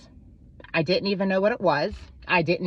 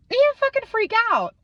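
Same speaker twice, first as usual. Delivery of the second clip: mock freak-out; 5 dB louder than usual. Mocking the ex's outburst.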